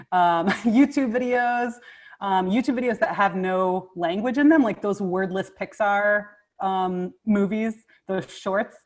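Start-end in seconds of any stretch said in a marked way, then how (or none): none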